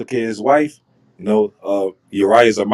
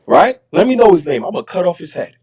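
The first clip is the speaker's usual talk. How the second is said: retold outburst, pitched up high; mock angry king; 7 semitones higher than usual. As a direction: play David demanding a name, hot and sharp. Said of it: "So David is mad, he's pissed off".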